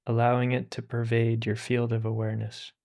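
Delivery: calm, unhurried meditation voice with long pauses; guided meditation. Acted. Teacher guiding listeners through meditation, calm and unhurried. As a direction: ease listeners into stillness.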